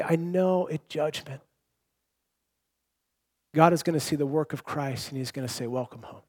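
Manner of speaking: hushed assurance. Retold or imitated. Preacher voicing a believer's hope, softly fading. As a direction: sink to a tender hush at "Welcome home". Believer's confident inner words.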